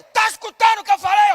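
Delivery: high, loud scolding holler; mother yelling to check her son heard; mother's yell. Retold or imitated. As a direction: shout it across the house.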